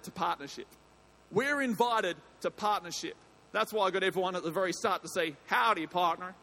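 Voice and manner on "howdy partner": a light put-on American Southern accent; half-hearted cowboy greeting in a Southern accent; mock Southern drawl. Shown as a demonstration. Pastor greets the church in a weak Southern accent. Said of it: "I don't think I'm doing it justice".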